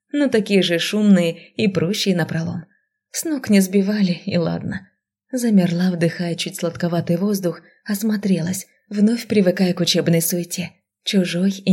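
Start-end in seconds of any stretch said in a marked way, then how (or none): none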